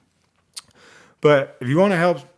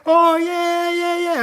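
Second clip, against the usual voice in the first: high crowd-style cheer, imitated; mock cheering; 5 dB louder, slower than usual. Everyone celebrates the marriage proposal, mock cheering. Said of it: "cheering and stuff".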